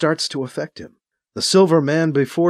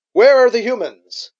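loud, higher ringing query; amplified voice; 7 semitones higher, 5 dB louder than usual. A narrator's reading. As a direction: call out through a speaker. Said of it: "his amplified query rings out".

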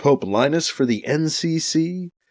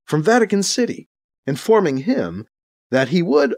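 ordinary delivery, nothing marked

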